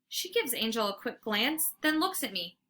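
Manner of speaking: usual speaking voice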